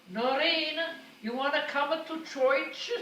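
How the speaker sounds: high, loud slow call; Italian mother calling daughter-in-law to church, loud. Retold or imitated. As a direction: call her from below, slowly. Mama calling before church.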